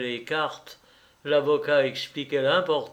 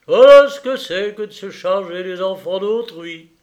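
loud, raised-pitch outburst; colonel's exclamation; 6 semitones higher, 12 dB louder than usual. Acted. Colonel lecturing the childless hosts, loud exclamation. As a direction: exclaim it forcefully, like a blunt colonel. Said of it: "s'écria le colonel".

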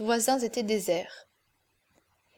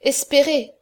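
espérez said louder and lifted; quoted word, stronger; 2 semitones higher, 6 dB louder than usual. A narrator's reading. Lagardère to himself, savouring her word, espérez pressed harder. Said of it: "Elle a dit".

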